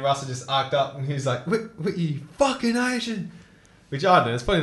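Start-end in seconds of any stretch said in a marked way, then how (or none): 1.5-3.3 high shouted insult, dragging out the last words; retold angry outburst; angry yell. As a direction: yell at the kid who threw your ball away. Russell yelling at Whitwer.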